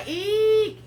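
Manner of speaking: short, high, loud "eek"; giggly squeal. Real, not acted. Painter slipping up mid-lesson to viewers, giggly squeal. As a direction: let out a high-pitched squeal.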